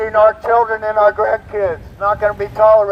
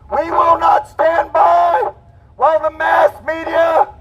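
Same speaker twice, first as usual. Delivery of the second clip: high, fervent rally speech; roaring rallying speech; 7 semitones higher than usual. Real, not acted. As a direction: rouse the crowd, full force. Protest speech to a crowd.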